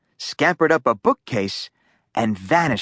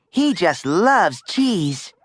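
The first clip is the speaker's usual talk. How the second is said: put-on little girl voice; 5 semitones higher, slower than usual. A narrator's reading. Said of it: "she said".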